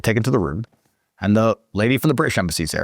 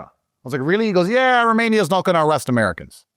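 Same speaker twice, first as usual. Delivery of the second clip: lightly voiced prison guard; 9 semitones higher than usual. Voicing guard, high pitched.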